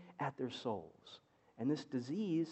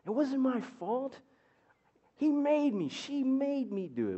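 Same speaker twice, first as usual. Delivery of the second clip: put-on whiny child voice; 10 semitones higher, 3 dB louder than usual. Child's excuse voiced in story.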